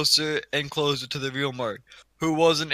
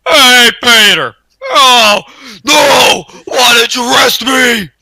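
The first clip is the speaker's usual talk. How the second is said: loud, high shouting Joe Swanson; Joe Swanson impression; 6 semitones higher, 13 dB louder, slower than usual. Demonstrating Joe to friends, loud high shout. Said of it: "Joe Swanson is".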